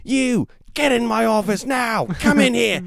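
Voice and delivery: high, loud barking orders; angry boss. Pretend. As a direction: order him into the office, furious. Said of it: "Is the sergeant played by Gordon Ramsay?".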